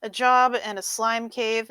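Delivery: normal talking voice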